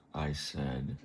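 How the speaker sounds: plain reading voice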